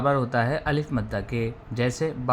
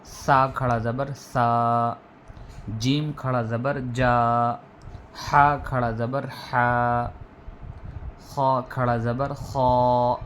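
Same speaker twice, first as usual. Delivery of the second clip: slow drill, each long vowel held; drawn-out recitation; slower than usual. Shown as a demonstration. Teacher leading the class through stretched letters. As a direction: chant the letters slowly and stretch each one. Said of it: "थोड़ा खींच कर पढ़ना चाहिए".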